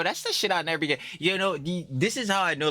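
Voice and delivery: ordinary talk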